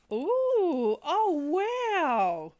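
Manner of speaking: high, loud ooh and wow; real wowed gasp of delight, voice swooping up; delighted surprise. Real, not acted. Opening a gift, delighted surprise.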